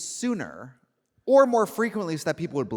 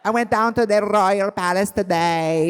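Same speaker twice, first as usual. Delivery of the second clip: slow, loud, higher Norwegian lilt; mock Norwegian accent; 5 semitones higher, 5 dB louder, slower than usual. Shown as a demonstration. Self-declared Swede demonstrating for the host, slow mock Norwegian accent. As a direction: exaggerate a drawn-out Norwegian accent. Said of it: "Norwegian accent, I mean, it's hard for me".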